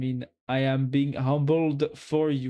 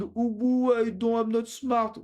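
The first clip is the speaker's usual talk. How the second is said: higher whining mock of a timid friend; mocking imitation; 7 semitones higher than usual. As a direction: parody the modest friend. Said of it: "Stop saying".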